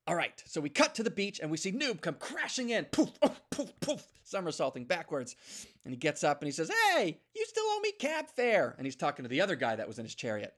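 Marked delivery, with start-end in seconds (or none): 2.9-4.1 mock crash sounds. Noob crashing onto beach. 6.9-8.7 high, squeaky little dog voice, pushy; a tiny cab driver demanding his fare; squeaky cabbie dog. Acted. Noob the dog nagging his passenger, high squeaky voice.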